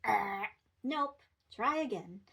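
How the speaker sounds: loud game-show buzzer then nope; wrong buzzer noise. Shown as a demonstration. Parent buzzing a wrong answer, loud game-show buzz. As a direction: buzz them like a quiz show. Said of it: "do the wrong buzzer noise".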